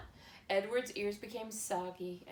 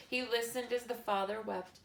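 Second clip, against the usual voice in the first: ordinary delivery, nothing marked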